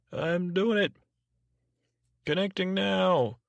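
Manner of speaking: high-pitched put-on operator; operator announcer. [name] puts the call through for Scooter, high operator voice. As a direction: sing it out high like a switchboard operator. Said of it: "having an operator, announcer".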